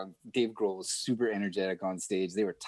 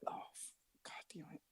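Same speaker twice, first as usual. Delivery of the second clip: muttered whispered curse; dismayed whisper; 7 semitones higher, 20 dB quieter than usual. Retold or imitated. Watching his friend walk over to the star, whispered curse. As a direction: curse under your breath in dismay.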